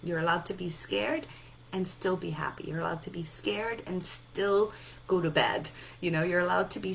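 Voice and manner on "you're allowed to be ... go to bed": usual speaking voice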